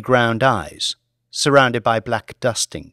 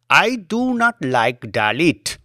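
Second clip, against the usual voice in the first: slow, disdainful, lightly voiced Indian driver; a driver sharing his scorn for the beggar, slow Indian accent; 5 semitones higher, slower than usual. Driver gives his opinion.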